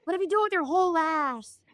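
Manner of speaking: high, airy diva voice throughout; Jennifer Coolidge voice. Pretend. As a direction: tell it as Jennifer Coolidge would, dreamily. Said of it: "through the voice of Jennifer Coolidge".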